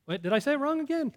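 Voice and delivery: surprised. Real, not acted. Realizes he slipped again.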